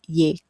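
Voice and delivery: "yệt" starting with a y sound, like yay; Southern spoken Y. Shown as a demonstration. Teacher models the non-standard Southern form, "yệt" with a y. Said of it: "spoken way is the Y sound".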